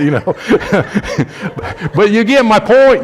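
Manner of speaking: usual speaking voice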